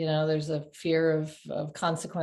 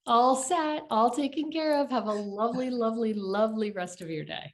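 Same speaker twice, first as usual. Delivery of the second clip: higher, bright customer-service lilt; sugary email sign-off; 5 semitones higher than usual. Retold or imitated. Friend parodying an overly sweet email, sing-song. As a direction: lay the politeness on thick.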